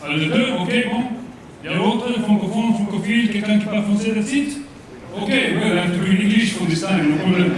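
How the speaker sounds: put-on thick comic French accent, vowels and r's Frenchified; fake French accent. Comedian as French coach Jean-Pierre thanks host, in mock French accent. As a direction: play an over-the-top Frenchman mangling English. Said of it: "you can probably tell from my accent".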